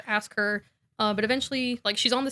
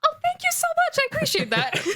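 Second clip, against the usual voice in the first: very high falsetto thanks; naive gushing; faster than usual. Retold or imitated. Harry thanking Ginny for the loose thread, gushing falsetto. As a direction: squeal the thanks with a laugh creeping in.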